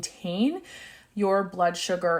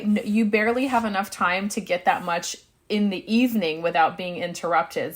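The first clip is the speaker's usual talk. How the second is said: ordinary talk, nothing marked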